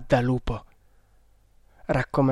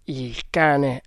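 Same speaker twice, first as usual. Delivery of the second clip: ordinary delivery, nothing marked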